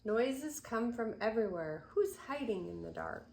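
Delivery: put-on gentle rhythmic read-aloud for children; storybook reading. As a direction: read the rhyme to little ones. Reads picture book aloud.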